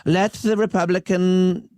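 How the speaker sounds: hammed-up Wisconsin accent mocking the court; bad Wisconsin accent. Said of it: "That's a really bad Wisconsin accent".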